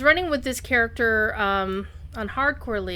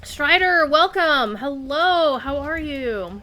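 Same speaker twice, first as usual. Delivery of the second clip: loud, bright-up welcome; high, loud stream welcome; excited greeting; 5 semitones higher, 8 dB louder, slower than usual. Real, not acted. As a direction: greet a friend who just showed up. Greeting a new chat viewer.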